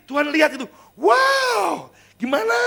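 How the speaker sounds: high loud preacher's wow; excited shout. Acted. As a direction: burst out in amazement. Preacher exclaiming at answered prayer.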